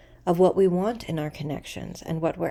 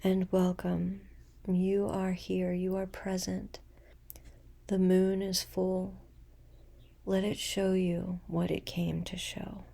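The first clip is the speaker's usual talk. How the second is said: guided meditation; 4 dB quieter than usual. Acted. Guiding listeners into a full moon breathing practice, meditative.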